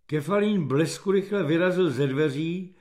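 usual speaking voice

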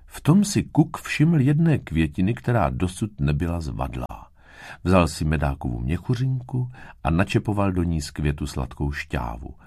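ordinary delivery, nothing marked

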